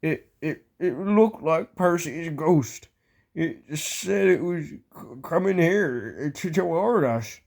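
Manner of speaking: put-on slow, stammering, mildly frightened old tram; shaky scared voice. Toby telling Thomas he saw a ghost, shakily. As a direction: stumble over the words as if spooked. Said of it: "said Toby in a shaky voice".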